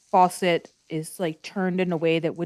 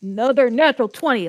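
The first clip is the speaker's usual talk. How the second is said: excited; 5 semitones higher, 7 dB louder, faster than usual. Real, not acted. Rolls a second natural twenty.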